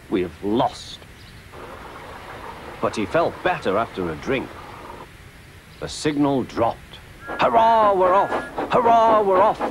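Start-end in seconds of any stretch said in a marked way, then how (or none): 0.1-1.0 downcast, defeated; dejected. A narrator's reading. Thomas dejected at losing. 7.4-9.6 high, loud triumphant cheer; excited puffing. A narrator's reading. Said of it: "puffed Thomas".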